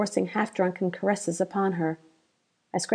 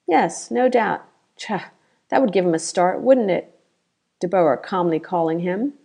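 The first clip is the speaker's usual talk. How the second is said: ordinary delivery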